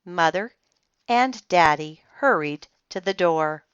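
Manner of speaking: plain reading voice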